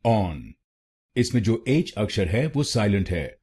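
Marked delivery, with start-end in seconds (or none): 0.1-0.4 on said cleanly, open o, no h; on isolated and spoken slowly for the class; first syllable alone. Shown as a demonstration. Teacher modelling the syllable on for learners. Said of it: "पहले शब्दांश को बोलिए on".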